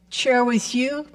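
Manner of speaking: ordinary talk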